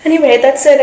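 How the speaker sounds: giddy. Real, not acted. Giddy laughing in work bathroom.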